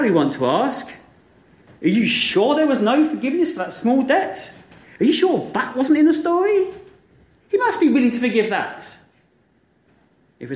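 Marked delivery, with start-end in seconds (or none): 1.8-9.0 high, disbelieving questions; can't believe the story goes this way; incredulous question. Acted. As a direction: listeners demanding a happy ending, incredulous. Listeners asking incredulous question.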